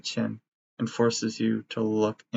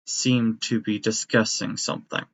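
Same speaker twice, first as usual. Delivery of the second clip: usual speaking voice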